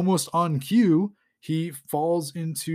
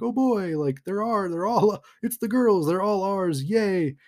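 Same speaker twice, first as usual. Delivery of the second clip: high, excited cartoon-crowd glee; gleeful cheering; 6 semitones higher, 3 dB louder than usual. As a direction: cheer it in a higher, louder voice. Bamfs thrilled to see the girls.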